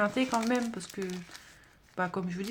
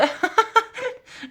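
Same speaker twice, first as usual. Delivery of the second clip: bright, high burst of laughter; 13 semitones higher, 6 dB louder than usual. Real, not acted. Vlogger laughs at her own joke about jealousy, high laugh.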